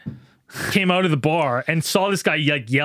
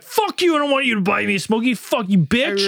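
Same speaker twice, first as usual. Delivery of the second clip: acted angry cursing; 5 semitones higher than usual. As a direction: imitate a guy cursing someone off. Stranger cursing him in the story.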